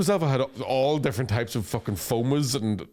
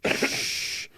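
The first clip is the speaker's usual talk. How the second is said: a breathy, creepy hush; mock sinister shushing; sadistic shush. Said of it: "sadistic, like".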